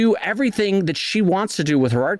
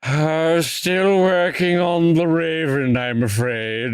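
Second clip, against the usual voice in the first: slow mock Virginia drawl as Poe; a lightly hammed Southern poet; much slower than usual. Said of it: "Virginia with, like, a drawl".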